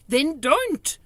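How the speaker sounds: loud, clipped short reply; sharp. A narrator's reading. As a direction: cut her off sharply. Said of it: "answered sharply".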